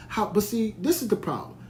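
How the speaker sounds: ordinary talk, nothing marked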